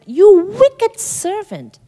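high, slow, drawn-out condemnation; mock-furious king; stern rebuke. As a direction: spit out wicked as the angry king. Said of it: "Not nice words".

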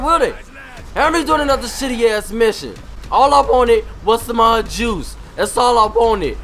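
loud, hyped-up rant, pitched high; excited ranting. Real, not acted. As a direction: whip up the rampage, shouting. Hyped rant during rampage.

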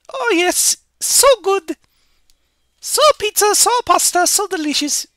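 very high, loud falsetto cartoon voice; gleeful cartoon character. Cartoon brother telling Luigi about construction next door, gleeful falsetto. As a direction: play an over-delighted cartoon brother in squeaky falsetto.

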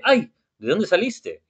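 mildly startled, as in a told story. Acted. Eliana meets a genie from the lamp, startled.

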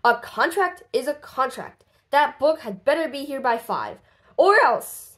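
rising to a loud threatening or else; angry threat. Acted. Boss threatens Andy over the late book, loud and stern. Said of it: "says Mister Big Nose".

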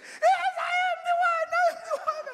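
the man jumps up proudly claiming it; excited shout. Retold or imitated.

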